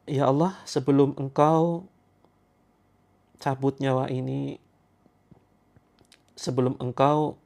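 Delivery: slow, earnest prayer voice with long pauses; leading a prayer. Acted. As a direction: plead to God slowly, drawing out each phrase. Leads a group prayer. (doa yang khusyuk dan pelan)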